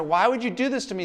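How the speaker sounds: offended relative. Retold or imitated. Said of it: "they take it personally and say".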